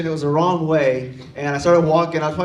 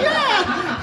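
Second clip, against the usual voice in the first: high-pitched, squealy yelp of a yeah; high squealed yes. Acted. Lost kid answers the driver offering help, high squeal. Acted eager reply to stranger.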